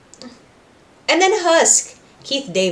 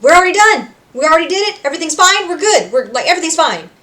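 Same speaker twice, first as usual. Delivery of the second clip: high, loud bright reassuring; chirpy reassurance; 8 semitones higher, 8 dB louder, faster than usual. Retold or imitated. Charlie telling Pentious it's all fine, high and chirpy. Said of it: "She's like".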